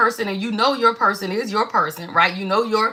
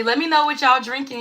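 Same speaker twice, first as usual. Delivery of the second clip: usual speaking voice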